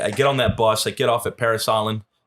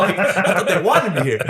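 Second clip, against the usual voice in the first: laughing disbelief; 7 semitones higher, 3 dB louder than usual. Retold or imitated. Recruit's bewildered thought retold.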